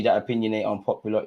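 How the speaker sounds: ordinary talk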